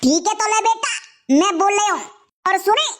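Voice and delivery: ordinary talk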